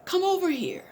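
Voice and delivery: loud high call to the crowd. Acted. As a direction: summon the people from afar. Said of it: "called to the people".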